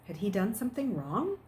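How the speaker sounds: ordinary delivery, nothing marked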